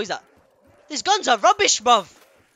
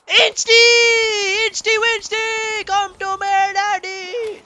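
high, drawn-out singsong coaxing; squeaky playful summoning; high-pitched calling; 9 semitones higher, 4 dB louder, much slower than usual. Pretend. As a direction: call it over in a silly high voice. Calling to a game item.

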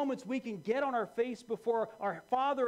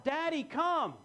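put-on high, loud child calling out; urgent child's cry; 8 semitones higher, 5 dB louder, slower than usual. Child cries out to father, urgent high call. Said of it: "it's a cry out".

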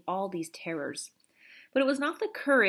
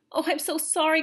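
high, louder, urgent apology; distressed cry; 9 semitones higher, 5 dB louder than usual. A narrator's reading. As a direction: cry out an apology, pitch jumping up. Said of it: "cried Omri".

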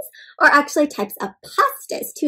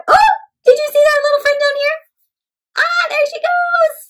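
excited; 3 dB louder than usual. Real, not acted. Excited reading to children.

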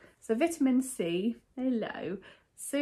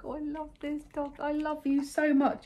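higher, tender doting voice; doting on the dog; 7 semitones higher than usual. Real, not acted. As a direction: owner cooing love to Rufus, higher and tender. Gushing to her dog.

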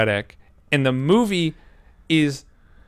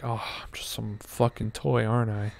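defeated Buzz; 8 dB quieter than usual. Retold or imitated. Buzz's defeated realization.

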